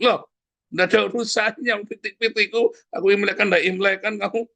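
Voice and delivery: forgive through clenched irritation; exasperated forgiving. Acted. Angry forgiveness, acted.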